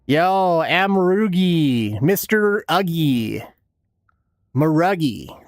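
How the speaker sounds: slow, stretched-out names; drawn-out greeting. Pretend. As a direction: draw each name out playfully. Greeting a viewer playfully.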